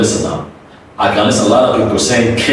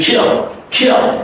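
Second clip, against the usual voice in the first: "kill" quick, glide squeezed before the dark L; fast version; 10 semitones higher than usual. Shown as a demonstration. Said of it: "you're gonna do it fastly".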